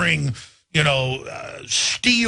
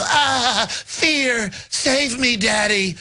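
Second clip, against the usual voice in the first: high, swooning mock plea to Daddy; 6 semitones higher, much slower than usual. Republicans mocked as begging Daddy in a swooning plea. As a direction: plead like a scared child to Daddy. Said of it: "the Republicans all just go".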